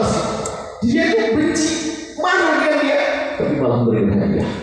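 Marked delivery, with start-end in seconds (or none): 0.9-3.0 much higher, excited stress on benci; emphatic exclaim. Real, not acted. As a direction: cry it out high. Emphasising the saint's hatred. (seruan tinggi bersemangat)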